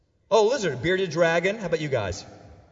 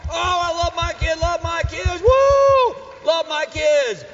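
high, loud whooping enthusiasm; mock parental cheering; 11 semitones higher, 9 dB louder, slower than usual. Preacher mimicking parents who love their kids, loud gushing cheer.

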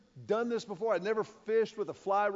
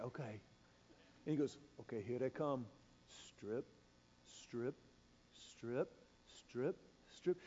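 much softer, low and clipped; whispered tension; 8 semitones lower, 12 dB quieter than usual. Retold or imitated. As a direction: breathe each command so the fish don't hear. Waiting as fish take fly.

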